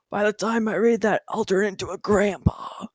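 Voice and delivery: lightly voiced old man; a hint of a grandpa impression. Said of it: "in, like, a grandpa voice".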